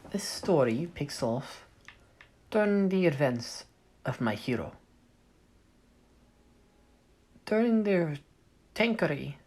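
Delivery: light, bad Russian accent. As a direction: narrate in a shaky Russian accent. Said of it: "I'll stop doing my Russian accent".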